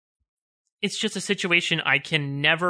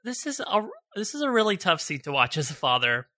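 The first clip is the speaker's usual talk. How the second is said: real tears, the voice cracking high on the first words; fighting to get the words out through emotion; voice breaking; 4 semitones higher than usual. Real, not acted. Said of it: "it's making me cry".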